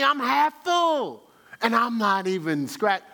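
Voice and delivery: impatient griping, dragged out; frustrated. Retold or imitated. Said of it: "now I'm frustrated because I'm going".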